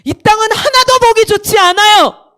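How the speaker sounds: high, loud preacher imitation; acted impassioned preaching; pastor's emphatic declaration. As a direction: proclaim it forcefully from the pulpit. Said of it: "이렇게 얘기하는 거야".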